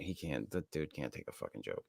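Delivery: ordinary talk, nothing marked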